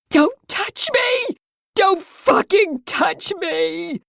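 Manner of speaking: high, slow, dragged-out scream. Acted. Mia screaming at the men holding her. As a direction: scream it at them. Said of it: "I screamed".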